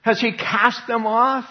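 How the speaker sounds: lightly hammed-up high skeptical voice; incredulous objector. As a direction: play the doubter who can't believe it. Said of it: "so then people will say".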